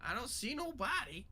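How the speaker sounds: high, loud goblin protest; indignant goblin. Acted. Goblin reporting after looking around, high loud disbelief. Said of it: "looks around and goes".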